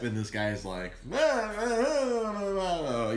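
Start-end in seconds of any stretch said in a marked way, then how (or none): 1.1-3.1 high, loud drawn-out whining protest; whiny protest. Retold or imitated. Customer whining slowly at the DMV clerk, high and loud. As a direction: stretch every ah out, whining in protest. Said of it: "he's being a bitch about it".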